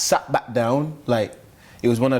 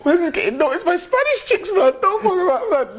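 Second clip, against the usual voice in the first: excited hyped boast. Retold or imitated. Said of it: "I was like".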